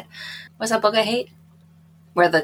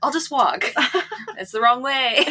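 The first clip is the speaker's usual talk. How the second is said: laughing quote; 7 semitones higher than usual. Retold or imitated. Quoting January stubbornly.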